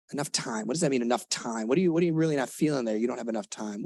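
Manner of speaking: usual speaking voice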